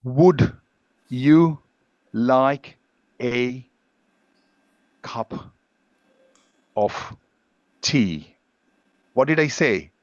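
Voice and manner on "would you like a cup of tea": "Would you like a cup of tea?" with a pause after every word. Shown as a demonstration. Teacher contrasting separated words with linked speech. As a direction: say each word separately, unlinked. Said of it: "I said".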